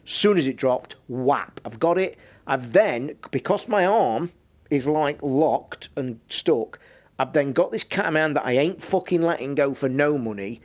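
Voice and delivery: ordinary talk, nothing marked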